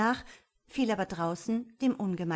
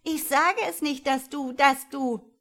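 put-on higher, louder singsong child's taunt, slowing at the end; jubelnd; 8 semitones higher, 8 dB louder than usual. A narrator's reading. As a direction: crow gleefully, dragging out the teasing last words. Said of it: "jubelte das Kind". (noch ausgelassener jubelndes Kind, hoch und laut)

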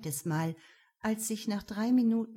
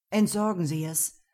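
curt; 6 dB louder than usual. Acted. Ben curtly orders disposal.

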